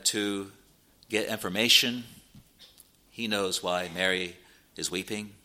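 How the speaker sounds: usual speaking voice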